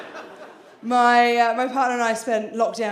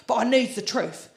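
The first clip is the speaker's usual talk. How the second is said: imitating dad; 6 semitones higher, 4 dB louder, faster than usual. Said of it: "He said".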